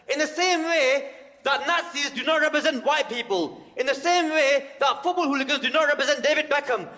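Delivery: high, loud hammed-up tirade; mock rant. Standing up to the man in McDonald's, loud high tirade. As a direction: rant it at full pitch. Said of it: "I stood up and went".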